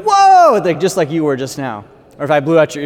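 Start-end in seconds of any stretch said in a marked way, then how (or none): 0.0-0.6 high, loud startled whoa. Retold or imitated. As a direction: jump back in surprise. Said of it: "Everyone's like".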